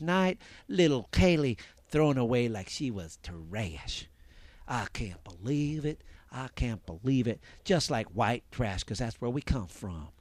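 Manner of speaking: Nancy Grace impression. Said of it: "That's how she talks".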